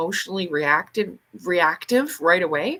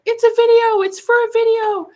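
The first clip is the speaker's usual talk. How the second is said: much higher, louder frantic yell; panicked yelling; 9 dB louder than usual. Retold or imitated. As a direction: scream it to stop the fight. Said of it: "she starts yelling".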